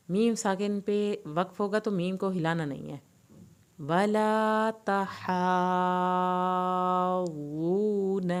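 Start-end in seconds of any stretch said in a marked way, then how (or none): 3.8-8.3 slow, drawn-out melodic tajweed recitation; Quran recitation. Shown as a demonstration. Teacher reciting the verse for students, slow melodic recitation. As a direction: chant the verse slowly, stretching every long vowel.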